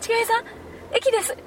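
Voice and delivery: high, urgent wake-up call; waking someone. Retold or imitated. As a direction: rouse a sleeping friend. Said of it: "って言っても".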